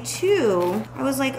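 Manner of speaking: ordinary talk, nothing marked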